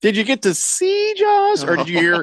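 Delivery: high teasing spooky voice; mock spooky voice. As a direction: ham up the horror. Teasing a blind listener.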